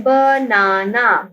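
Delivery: Banana drawn out in three slow syllables. Shown as a demonstration. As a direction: break banana into ba, naa, naa. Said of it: "हमारे पास three syllables हैं".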